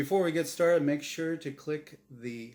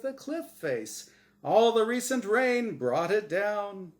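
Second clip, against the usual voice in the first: much higher, loud showman energy; hyped game show host; 6 semitones higher, 6 dB louder than usual. Acted. Host insisting this is real, wild showman hype. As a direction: hype it up like live TV.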